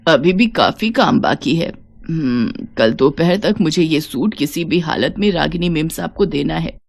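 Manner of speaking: low, soft talking to herself; tired elderly woman thinking aloud over late-night work; weary old seamstress. Acted. Old Savitri mutters while sewing late.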